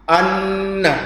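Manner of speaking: Anna with the n held before release; held double n. Shown as a demonstration. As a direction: hold the nn in Anna, then release into a. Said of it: "Kita tahan an, lalu kita lepaskan".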